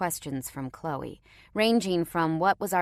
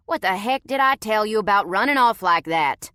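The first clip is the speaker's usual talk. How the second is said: louder Southern big brother scolding; 4 semitones higher, 8 dB louder than usual. A narrator's reading. Brother scolding the little girl who ran off, Southern twang. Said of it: "His country twang accent much more prominent".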